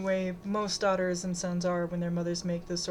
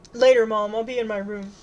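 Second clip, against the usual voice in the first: put-on loud, hurried teenage brush-off; loud dismissal in passing; 3 semitones higher, 9 dB louder than usual. A narrator's reading. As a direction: call it back to Mom on the way out. Daughter brushes mother off.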